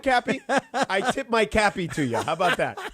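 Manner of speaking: real amused laughter through the words; laughing concession. Real, not acted. Host tipping his cap to his co-host, laughing. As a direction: concede the point while laughing.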